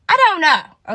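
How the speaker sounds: high, loud imitated exasperation; exasperated reply. Answering friend asking why nobody praises her, exasperated.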